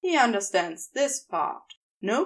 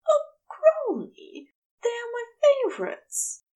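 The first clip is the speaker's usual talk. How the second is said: delighted exclamation; 14 semitones higher than usual. A narrator's reading. Angel sees his favorite chocolates, much higher delighted gush. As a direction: swoon over the chocolates. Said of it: "exclamation of delight".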